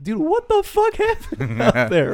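mock amazement, cracking up; slow, laughing disbelief at the boss. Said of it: "and I'm like".

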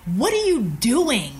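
slow, drawn-out scornful question; disdainful. Retold or imitated. Executive questions the ex-engineer, slow voiced disdain. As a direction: stretch the words out with open disdain. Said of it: "with such disdain in his voice".

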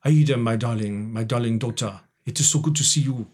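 put-on Aida's father in an African accent. Father greeting his daughter Aida on stage, put-on African accent. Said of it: "I'd come out and do things like".

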